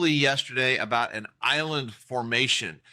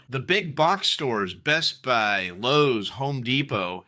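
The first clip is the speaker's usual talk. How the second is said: ordinary talk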